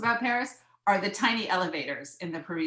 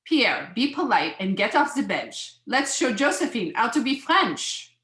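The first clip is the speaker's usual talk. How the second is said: lilting put-on French accent; mock French mother gently scolding; bad French accent; 5 semitones higher, 4 dB louder than usual. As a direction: chide a child in a fake French accent. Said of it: "I'm gonna do my bad French accent".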